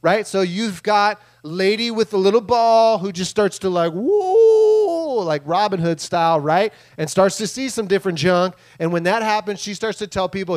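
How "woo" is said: long, high, loud wailing "woo"; imitated eerie woo. Fortune teller starting to see things, drawn-out high woo. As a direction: hold a high mystic woo over a crystal ball.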